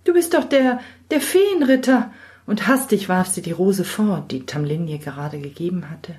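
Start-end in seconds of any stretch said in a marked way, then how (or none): 0.0-2.1 put-on high, gasping girl recoiling in fright; young girl crying out in fear; frightened girl cry. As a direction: shrink back in alarm. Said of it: "rief das Mädchen".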